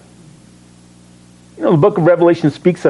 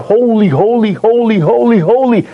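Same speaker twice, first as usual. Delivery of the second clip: crying out holy; 5 semitones higher than usual. Acted. Seraphim crying out in awe.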